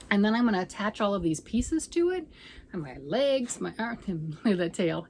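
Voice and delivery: ordinary talk, nothing marked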